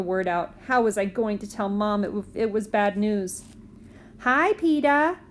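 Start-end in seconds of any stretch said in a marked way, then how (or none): 4.2-5.2 put-on higher, slow small-boy voice; lightly voiced little boy. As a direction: sound like a cheeky little kid on the phone. Fudge's voice on phone.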